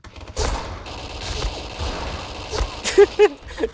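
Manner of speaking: high unvoiced burst of laughter. Real, not acted. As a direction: cackle at the blunder. Laughs at own bad spot.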